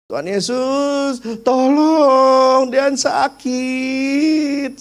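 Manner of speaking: put-on high, slow small child's voice pleading; child crying out to Jesus for help; toddler's cry for help. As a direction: plead like a sick toddler, stretching the words. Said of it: "dia ngomong gini".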